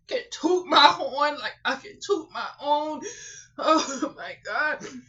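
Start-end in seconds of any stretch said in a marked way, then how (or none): none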